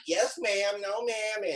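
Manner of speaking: high, mincing mock-polite voice; fake politeness. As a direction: mimic someone being overly proper. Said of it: "The way you were talking".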